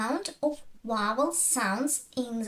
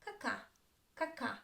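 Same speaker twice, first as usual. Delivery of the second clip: ka-KA, "caca" with short first "a", clear second; first syllable unclear; 12 dB quieter, faster than usual. Shown as a demonstration. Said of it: "The first not".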